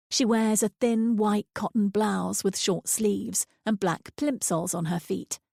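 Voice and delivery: ordinary delivery, nothing marked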